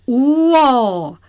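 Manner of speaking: high, drawn-out storybook call. Acted. Character calls out reassurance to all. (拉长声音高声喊话)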